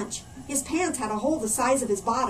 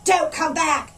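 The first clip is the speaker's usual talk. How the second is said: louder, higher rowdy call; 5 semitones higher, 7 dB louder, slower than usual. A narrator's reading. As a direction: heckle him as he leaves. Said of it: "called out another".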